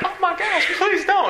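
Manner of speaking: hammed-up terrified boy begging; begging Isaac. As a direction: squeal the plea comically. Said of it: "Isaac's like".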